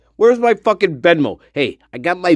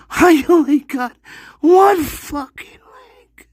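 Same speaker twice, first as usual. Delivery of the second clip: high, slow whispered whine; whiny whisper; 9 semitones higher than usual. Acted. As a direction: whimper it in a thin pitiful voice. Whine about one leg.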